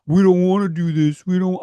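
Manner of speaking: lightly voiced sulky complainer; mock whiny refuser. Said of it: "but you, if".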